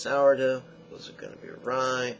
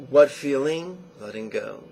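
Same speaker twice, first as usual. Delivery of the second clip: guided practice; 6 dB louder than usual. Shown as a demonstration. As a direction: lead the noting practice unhurried. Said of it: "you don't go that fast".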